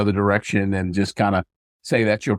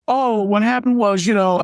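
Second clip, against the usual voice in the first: high-pitched imitation of a stalling son; mock excuse, pitched way up; son making excuses; 11 semitones higher than usual. Son caught, starts excuse to dad.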